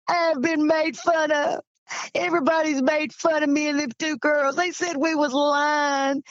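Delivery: mildly tearful, wounded protest; retold tearful complaint. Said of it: "He just started crying".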